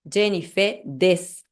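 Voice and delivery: "des" ending on the S, no U; slow demo; final u dropped. Shown as a demonstration. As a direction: cut the vowel of "desu" and stop on "des". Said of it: "A pronúncia é, até S".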